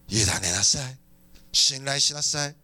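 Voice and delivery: low, measured command from God; divine reassurance. Acted. God telling an anxious believer to trust, divine reassurance. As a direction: lay each instruction down deep and steady. Voices God's answer to worry.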